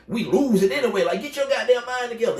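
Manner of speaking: rising exasperated plea. Retold or imitated. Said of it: "He telling him".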